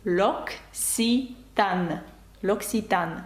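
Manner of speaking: L'Occitane drawn out very slowly, as "lok-si-TAN", stress on the end; L'Occitane said the French way. Shown as a demonstration. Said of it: "як правильно вимовляти цю літеру".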